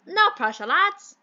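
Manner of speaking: British gent, rising high; bad British accent. Mentor reassures the lads, mock British accent. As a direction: toss it off in hammed-up British. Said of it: "do the worst British accent".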